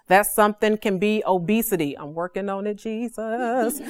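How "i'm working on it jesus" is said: much softer, tossed-off joking aside to Jesus; quiet aside. Real, not acted. Preacher joking to Jesus about her weight, quiet aside. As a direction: drop the voice for a little confession.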